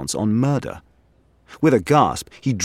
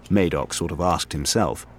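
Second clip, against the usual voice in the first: plain reading voice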